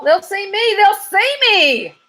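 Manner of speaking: a scared squirrel panicking; high, slow, whining panic; panicky cry. A narrator's reading. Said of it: "cried the squirrel".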